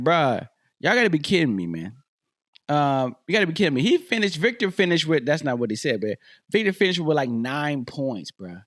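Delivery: ordinary talk, nothing marked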